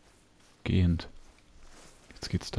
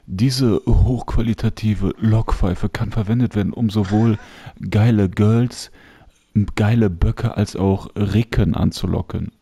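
ad read; 6 dB louder than usual. Acted. Reading item description aloud.

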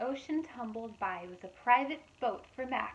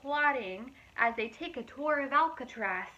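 lively, louder storybook narration; reading to children; 4 semitones higher, 8 dB louder than usual. A narrator's reading. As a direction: read it out brightly to a young audience. Reading picture book aloud.

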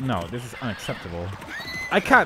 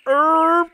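high, loud squeaky erp; mock deer call; 8 dB louder than usual. Imitating the mother deer's call, a high loud squeak. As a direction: yelp it like a startled deer. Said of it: "the mother just going".

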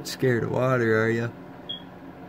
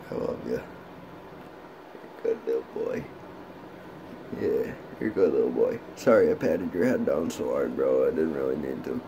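tender low pet talk; 10 semitones lower than usual. Real, not acted. Cooing low to pet lizard.

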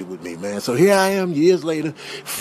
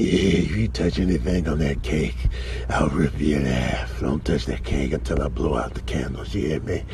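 very low, growled warning; deep, controlled menace; menacing threat; 4 dB quieter than usual. Acted. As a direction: warn them off in a deep voice. Threatens over birthday cake.

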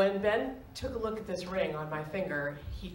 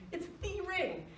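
much higher, softer breathless echo of "the ring"; hushed awe; 11 semitones higher, 6 dB quieter than usual. Real, not acted. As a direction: whisper it again in wonder. Echoing the reveal in awe.

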